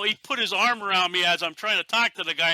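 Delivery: ordinary delivery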